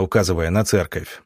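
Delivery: ordinary delivery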